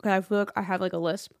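talking around chewing gum. Real, not acted. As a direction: speak with gum in your mouth. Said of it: "I have gum in my mouth".

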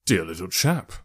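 low, lightly coaxing; understated calculated charm; strategic fondness. A narrator's reading. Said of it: "said Holmes strategically".

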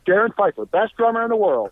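high, exasperated-sounding announcement; mock proclamation. John throwing his hands up at the drummer, mock proclamation. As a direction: proclaim it in sarcastic surrender. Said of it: "threw his hands up in the air".

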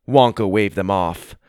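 ordinary delivery

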